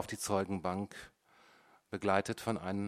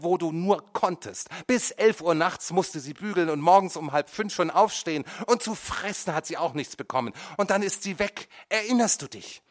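high, loud, bitter charge; accusing; 9 semitones higher, 8 dB louder than usual. A narrator's reading. Son accuses his mother, high and loud. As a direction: press the guilt on her.

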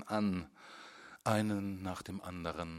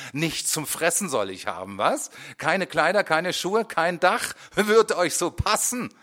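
loud, higher, bitter sarcasm; defiant tirade; 9 semitones higher, 12 dB louder than usual. A narrator's reading. Teacher raging against his pupils, loud and bitter. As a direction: hurl it at them, furious.

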